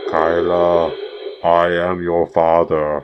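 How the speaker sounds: slow, drawn-out Darth Vader impression; slow, ominous Vader voice. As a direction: play Darth Vader, stretching the words out slowly. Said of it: "What does he sound like?".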